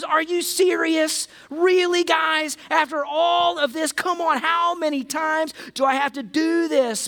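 imagined Jesus fed up with the frightened disciples, exasperated. Retold or imitated. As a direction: throw up your hands, hammed-up. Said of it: "And so then Jesus goes".

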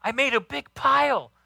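put-on high, bright little boy voice, calling out; a small boy proud of his leaf pile; little son's voice. Son calls through the window to dad, high little boy voice. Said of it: "My son Tristan's raking leaves".

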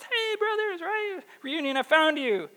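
high, sing-out hello; mock-cheerful greeting. Joseph greets the brothers he has found, hammed-up cheerful. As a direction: call it out high, like a happy reunion.

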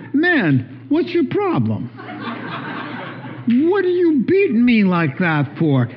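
high, put-on donkey voice, indignant; talking donkey. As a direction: play the beaten donkey complaining to her master. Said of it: "The donkey turns her head and says".

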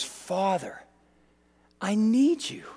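hushed, slightly breaking off between phrases; soft plea. Retold or imitated. Man turning to God in crisis, hushed plea. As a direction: pray it softly, with pauses. Replays prayer behind the panic.

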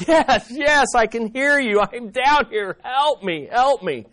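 high, urgent pleading; acted cry of a stranded man; desperate man. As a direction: answer eagerly, begging to be rescued. Man on cliff answers voice.

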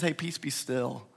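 soft and slow, gentle authority; Jesus quietly calming the sea; calm command. Retold or imitated. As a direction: speak stillness into the storm. Jesus calms the storm.

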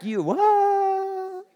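high, drawn-out shocked question; incredulous. Acted. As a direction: react in total disbelief. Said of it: "you're like".

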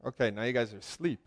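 ordinary talk, nothing marked

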